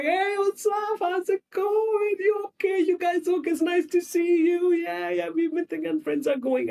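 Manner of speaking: very high, gushing social voice; over-cheerful greeting. Acted. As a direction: greet friends with exaggerated delight. Acted cheerful boy greeting his friends.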